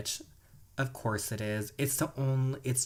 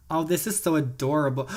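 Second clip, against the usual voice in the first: ordinary talk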